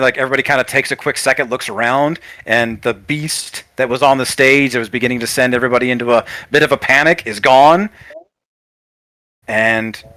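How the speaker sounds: usual speaking voice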